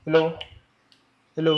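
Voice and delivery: ordinary talk, nothing marked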